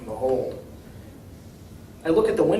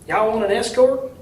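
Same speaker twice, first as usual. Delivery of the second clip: higher, folksy Southern mayor, eager and hopeful; a down-home mayor, friendly and a bit anxious; small-town mayor; 7 semitones higher, 5 dB louder than usual. A narrator's reading. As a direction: play the hopeful country mayor asking for help. Mayor voiced in story.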